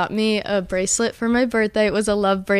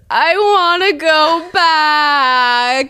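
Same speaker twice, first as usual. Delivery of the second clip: slow, high, louder mock sob; teasing impression of friend sobbing, high and slow; imitating her crying; 7 semitones higher, 5 dB louder, much slower than usual. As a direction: wail it like a crying friend, drawn out. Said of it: "She was crying".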